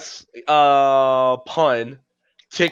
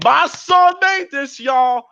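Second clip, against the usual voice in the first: much higher, slow proud call; proud mama; 10 semitones higher, slower than usual. Retold or imitated. Proud mama showing her son's mural, high and slow. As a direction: beam and show off. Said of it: "A proud mama saying".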